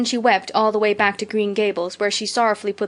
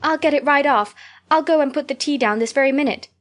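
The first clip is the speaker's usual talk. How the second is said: higher, louder, eager rush; 5 semitones higher, 3 dB louder than usual. Acted. Anne rushing to serve Diana tea. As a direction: hurry the offer out anxiously.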